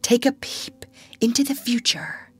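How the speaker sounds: whispered, secretive storyteller; mysterious whisper. Acted. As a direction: lure them in with a hush. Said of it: "bracing herself up for her finest effort".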